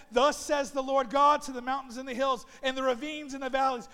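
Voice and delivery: loud, declaiming pulpit voice; fiery sermon proclamation; preaching at full force. Acted. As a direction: thunder the scripture at them.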